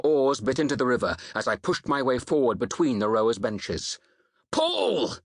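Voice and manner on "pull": high-pitched battle roar; roared command. A narrator's reading. Ralla urging the rowers on, roared. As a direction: roar the stroke order at the rowers. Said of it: "Ralla roared".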